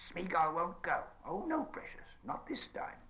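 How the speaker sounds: high, hissing creature refusal; hissed. Acted. Gollum hisses his refusal to Sam. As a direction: hiss a stubborn no. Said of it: "hissed Gollum".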